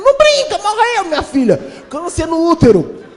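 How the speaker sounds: very high, loud gasping voice; shocked gossip. Retold or imitated. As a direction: shriek it like a scandalized cleaner. Second cleaner reacting.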